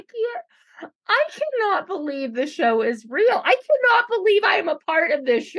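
can't believe this show is real; exasperated disbelief. Real, not acted.

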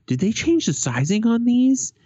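high-pitched, baffled question; incredulous customer. Retold or imitated. Man trying on high tops asks clerk, incredulous. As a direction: imitate a customer puzzled, pitch climbing high.

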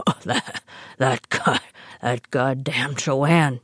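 imitating a choked-up, proud grandpa, slow; mock the old man overcome with pride; a hint of a tearful, proud grandfather, slow. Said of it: "wiping tears of pride from his eyes".

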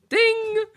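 high ringing "ding"; imitated ding. Bird catcher demonstrates the sighting, high-pitched ding. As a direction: chime a very high, held ding. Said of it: "it was like".

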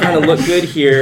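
said through laughter; laughing. Real, not acted. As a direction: laugh it out, high and loud. Joking after friend's jab.

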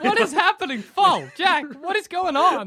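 high, bewildered parent; a dad panicking at his son; dad finds his son acting like a dog, alarmed. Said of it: "dad like".

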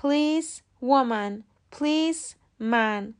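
police officer, police woman, police man said slowly and separately; slow model words; slow careful demo. Shown as a demonstration. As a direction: list each one slowly for learners.

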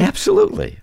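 high, emphatic absolutely; emphatic yes. Real, not acted. Fully agreeing with the host, emphatic. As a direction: agree with everything you have. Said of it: "emphatic agreement".

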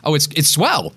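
high-pitched put-on American accent; mimicked American chirpiness. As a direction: awkwardly slip into American. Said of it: "starting talking in an American accent".

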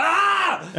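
high, loud imitated scream; startled scream. Dad jolted awake by blasting YouTube, startled scream. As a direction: cry out in mock shock. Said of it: "svinhögt. Jag bara".

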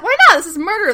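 imitating an eager friend, voice leaping high; eager pitch. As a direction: burst in loud and high, egging a friend on. Acting out Gretchen's eager pitch.

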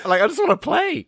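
high-pitched impatient whine; whining plea. Real, not acted. Bored player begs host to start the game, whining.

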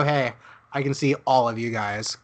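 normal talking voice

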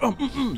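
high-pitched closed-mouth humming; wordless underwater signal. Acted. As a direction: hum urgently as if unable to speak. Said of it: "You start signaling for him".

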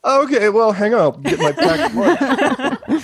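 high-pitched, laughing joke; real amused laughter in the voice. Real, not acted. Jokes about having plenty of time.

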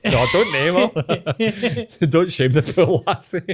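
laughing it out, teasing. Real, not acted. Telling his friend not to name her, laughing.

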